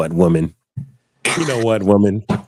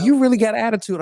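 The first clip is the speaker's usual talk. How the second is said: higher, teasing woman's voice; imitating the woman; 9 semitones higher, faster than usual. The woman teasing David about his attitude, higher mock voice. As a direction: mimic her surprised tease, pitched up. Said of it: "And she was like".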